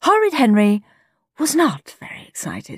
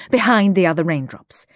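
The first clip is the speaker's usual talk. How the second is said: plain reading voice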